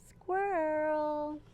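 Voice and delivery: ordinary delivery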